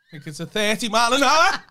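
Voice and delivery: loud, high, over-eager course answer; mock keen answer. Repeating driver course answers, mock-keen high voice. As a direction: call it out high, breaking into laughter.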